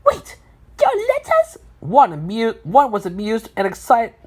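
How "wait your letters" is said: very high, astonished question; amazed and excited. A narrator's reading. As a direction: ask it in a high voice, thrilled and amazed. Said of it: "was amazed and excited".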